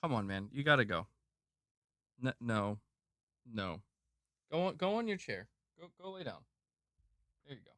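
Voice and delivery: hushed, gentle commands to a pet; talking softly to dog. Real, not acted. Streamer quietly tells his dog to lie down, softly coaxing. Shooing dog onto its chair.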